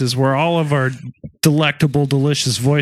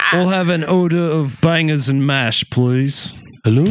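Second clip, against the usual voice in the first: mock-proper English accent. Shown as a demonstration. Ordering bangers and mash in a contest, English accent. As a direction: put on an English accent, drawing out the vowels. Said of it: "five-second English accent impression".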